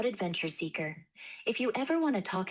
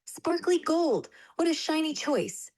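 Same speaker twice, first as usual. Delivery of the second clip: excited; 7 semitones higher than usual. Acted. AI cheers child's choice.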